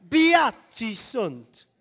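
very loud, drawn-out hammy Italian accent. Shown as a demonstration. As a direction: boom it out slowly like a pretend Italian. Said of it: "pretending to be Italian".

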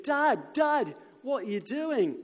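high, loud cry of a struggling son; acted panicked yell to a father; screaming. Isaac cries out to his dad as he's tied up, screaming. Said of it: "I imagine Isaac was screaming".

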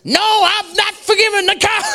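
high, loud indignant refusal. Retold or imitated. Texan woman refusing the speaker, indignant and loud. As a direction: snap back, still furious at the cow. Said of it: "She was really quite angry with this cow".

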